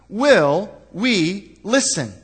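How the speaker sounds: will we listen drawn out slow, weighed word by word; slow searching question. Shown as a demonstration. Pastor putting the morning's question to the church, slow and weighty. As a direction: let the question hang, slowly. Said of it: "See, the question is".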